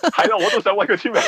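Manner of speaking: laughing, high and quick. Real, not acted. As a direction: giggle through it, fanboy glee. Laughing about wanting autograph too. (笑住講，興奮咁)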